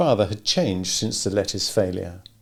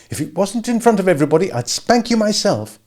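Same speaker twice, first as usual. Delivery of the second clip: angry scold; 11 semitones higher, 4 dB louder than usual. A narrator's reading. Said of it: "face reddened with anger".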